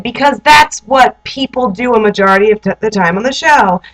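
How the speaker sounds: ordinary delivery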